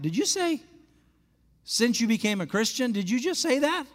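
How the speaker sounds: high, amazed disbelief; stunned pastor asking her to repeat it; astonished. Retold or imitated. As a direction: react like you can't believe your ears. Pastor reacts amazed to her news.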